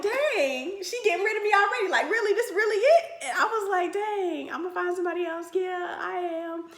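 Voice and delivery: dramatic self-pity; hurt sarcasm. Retold or imitated. Girlfriend hurt by mother's advice, high wounded. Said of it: "even I was like".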